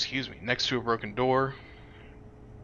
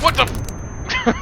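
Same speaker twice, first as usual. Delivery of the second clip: high-pitched yelling; high shriek; 9 dB louder, slower than usual. Real, not acted. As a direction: shriek it out in panic. Shocked at falling into space.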